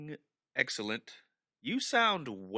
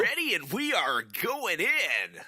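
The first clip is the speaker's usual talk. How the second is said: cheery host voice; 7 semitones higher, 6 dB louder than usual. Acted. Joins the mock cheerful host bit.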